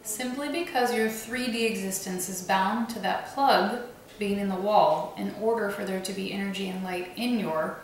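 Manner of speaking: ordinary delivery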